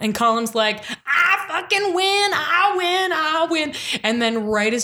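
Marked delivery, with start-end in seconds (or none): 1.1-3.7 high, drawn-out mock triumph; gloating victory cry. Old uncle thinks he has won the property, mock gloating. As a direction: crow it like a gloating winner.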